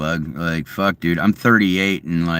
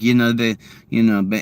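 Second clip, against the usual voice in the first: usual speaking voice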